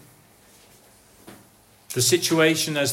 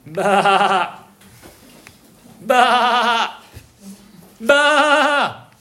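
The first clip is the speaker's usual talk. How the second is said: sheep bleating; 5 semitones higher, 4 dB louder, much slower than usual. Acted. Lost sheep bleating to the shepherd, building louder.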